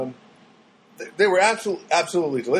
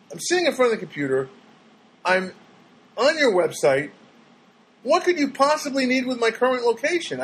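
indignant rant; 8 semitones higher than usual. Retold or imitated. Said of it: "I go".

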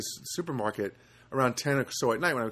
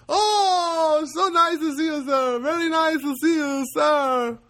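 very high, loud, gushing imitation; overjoyed Filipino guy; 6 dB louder, slower than usual. Supermarket worker tells a customer they worried, high and overjoyed. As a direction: gush in a piercing put-on voice. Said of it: "he was so happy to see me".

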